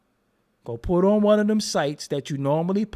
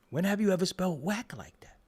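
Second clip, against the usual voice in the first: hushed, conspiratorial aside; mock whisper; 2 semitones higher, 6 dB quieter than usual. Asking the fellas about the spelling, whispered. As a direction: whisper it to the boys behind his back.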